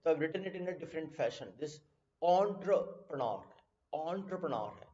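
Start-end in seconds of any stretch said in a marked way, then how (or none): 2.2-4.8 entrepreneur as ON-truh-puh-NAR; exact pronunciation. Shown as a demonstration. Teacher revealing the tricky word, entrepreneur as ON-truh-puh-NAR. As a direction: model entrepreneur slowly, opening on "on". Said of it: "exact pronunciation".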